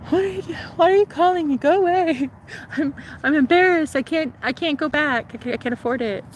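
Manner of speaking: high, fast teary pleading; mock tearful panic. To Willie, mock tearful high pleading. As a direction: retell herself crying on the phone, pitched high.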